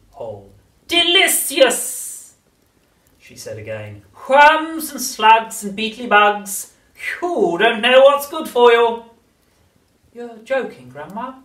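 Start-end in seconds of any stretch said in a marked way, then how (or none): 0.9-2.4 high, slow crone voice; witch voice. A narrator's reading. Grandma savoring. 4.3-9.0 high, slow crone voice; witch voice. A narrator's reading. 10.1-11.4 put-on high, startled boy; a young boy, uneasy; little boy voice. A narrator's reading. George voiced, child.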